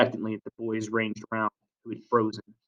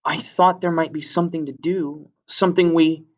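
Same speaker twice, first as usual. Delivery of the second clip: put-on higher, hesitant boy breaking off; voice failing under pressure; 6 semitones higher than usual. A narrator's reading. Simon faces the jeering assembly, hesitant and higher. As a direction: start tentatively, then lose the words. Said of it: "pressure of the assembly took his voice away".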